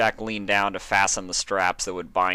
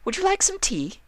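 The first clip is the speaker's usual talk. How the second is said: much higher, sugary hostess voice; Rarity's sweet offer. A narrator's reading. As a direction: offer it all smiles, overly polite.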